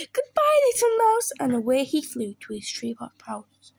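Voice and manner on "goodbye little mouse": high, loud frightened goodbye; fleeing owl, voice way up; panicked farewell. Acted. Owl flees mouse and Gruffalo, panicked.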